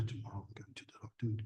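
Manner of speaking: quick whispered aside; whispering. Real, not acted. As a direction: whisper it quickly under your breath. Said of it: "whispered translation aside".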